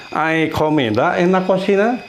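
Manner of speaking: "Hay comida en la cocina" rising at the end; question intonation. Shown as a demonstration. Teacher shows students how to read it as a question, questioning intonation. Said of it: "intonasinya kita buat intonasi bertanya".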